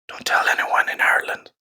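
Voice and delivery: hushed mock-secret whisper; confiding whisper. Teacher confesses to secretly preferring coffee, conspiratorial whisper.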